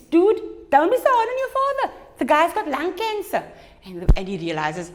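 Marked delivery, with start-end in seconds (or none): 0.1-3.4 very high, loud mother voice; mother's scolding. Acted. Mother telling son not to be hard on his father, very high. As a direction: scold him in a high mom voice. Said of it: "His mom says".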